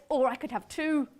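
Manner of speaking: ordinary talk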